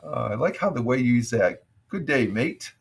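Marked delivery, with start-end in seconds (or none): 1.9-2.7 lightly put-on Australian greeting; imitated Australian accent. Viewer's greeting echoed back, lightly imitated Australian accent. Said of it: "the Australian accent and stuff like that".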